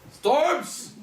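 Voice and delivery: high, loud dramatic cry; a big overacted shout of the word; hammed-up exclamation. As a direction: ham it up like a bad tragedian. Bottom hamming his line.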